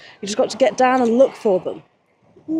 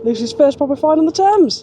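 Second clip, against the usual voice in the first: louder, higher proud announcing; proud announcement; 5 semitones higher, 5 dB louder than usual. Real, not acted. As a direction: proclaim the find proudly. Announcing friend's find.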